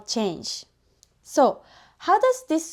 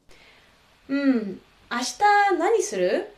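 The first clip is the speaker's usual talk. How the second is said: casual native speaker; 3 semitones higher than usual. Shown as a demonstration. Teacher voicing a friend asking about tomorrow, casual. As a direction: toss the question off like a friend. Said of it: "let me demonstrate how natives actually sound".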